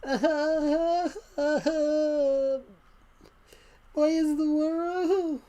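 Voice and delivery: exaggerated high-pitched wail, drawn out slowly; loud, hammed-up crying, one long high howl; mock crying. Shown as a demonstration. Streamer showing what crying would sound like, mock wailing. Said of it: "I wouldn't sound like this".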